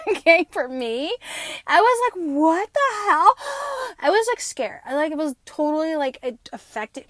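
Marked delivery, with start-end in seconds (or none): 2.2-3.3 shocked disbelief. Retold or imitated. Retelling her shock. 3.4-3.9 sharp high gasp. Retold or imitated. Retelling fright.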